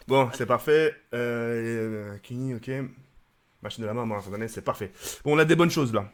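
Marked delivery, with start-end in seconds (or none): none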